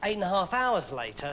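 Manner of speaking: usual speaking voice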